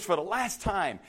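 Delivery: normal talking voice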